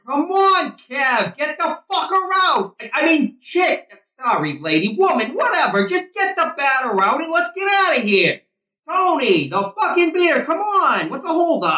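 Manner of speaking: shouting. Acted. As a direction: yell out to the outfielder and back to Tony. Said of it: "Eddie shouts out to the field".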